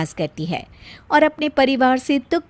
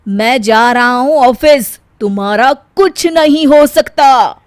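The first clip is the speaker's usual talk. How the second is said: loud and slow, giving up on her; slow, exasperated sign-off; husband leaving for office, exasperated; resigned exasperation; 9 dB louder than usual. Acted.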